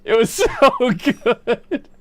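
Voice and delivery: ordinary talk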